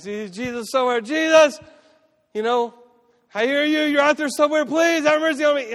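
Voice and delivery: high, loud pleading shout; acted cry for mercy at full voice; desperate calling out. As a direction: shout for help like a blind beggar. Acts blind men calling to Jesus.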